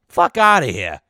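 usual speaking voice

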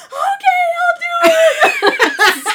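very high, loud, slow laughing yell; laughing shout. Retold or imitated.

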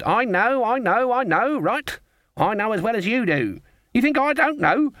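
high-pitched, impatient insistence; exasperated retort. A narrator's reading. Granny rounds on Nanny for explaining, exasperated. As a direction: cut them off with mounting irritation, pitched high. Granny snaps at being told.

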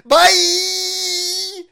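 high, loud, drawn-out mock bye; mocking imitation. Host parroting the clip's goodbye, mocking. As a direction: mimic a sugary sign-off at full volume.

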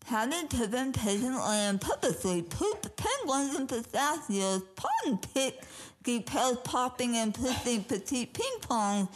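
tongue held, sounds slurred and thick; holding tongue. Shown as a demonstration. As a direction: say it while holding your tongue. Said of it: "I gotta hold my tongue".

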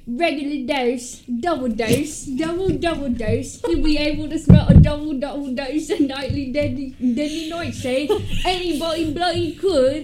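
hammed-up, lively Cockney; stupid Cockney accent. Reading Sally mixing doses for Nightmare Before Christmas, stupid Cockney accent. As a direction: butcher it in an over-the-top Cockney voice. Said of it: "let's do the stupid Cockney one".

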